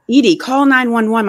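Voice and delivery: loud, high emergency order; urgent shout. A narrator's reading. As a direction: shout for someone to phone for help. Father shouting to frozen mother.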